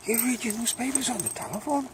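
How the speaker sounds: high, incredulous rising question; mock disbelief. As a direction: act amazed at reading news on a phone. Mock old-timer surprised at phones.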